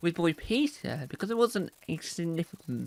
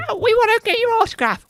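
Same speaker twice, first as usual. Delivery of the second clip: very high, loud fan squeal; squeaky fans; 11 dB louder, faster than usual. Acted. Fans asking presenter for autograph, squeaky put-on squeal. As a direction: squeal it like overexcited mock fans.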